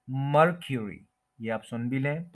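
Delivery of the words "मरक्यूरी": मरक्यूरी as "MUR-kyu-ree"; first-syllable stress. Shown as a demonstration. Teacher showing where stress falls, stressed pronunciation. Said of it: "first के ऊपर stress है".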